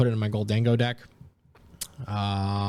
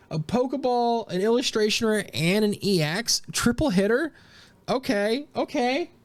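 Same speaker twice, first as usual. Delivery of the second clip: much higher, buzzing count of hits; excited listing; 10 semitones higher than usual. Real, not acted. Counting the hits for viewers, high and excited. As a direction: tick off each hit with glee.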